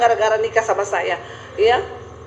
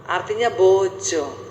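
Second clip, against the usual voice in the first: usual speaking voice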